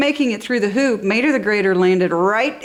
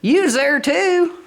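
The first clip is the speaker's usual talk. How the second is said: insistent Mater; 7 semitones higher than usual. A narrator's reading. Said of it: "said Mater".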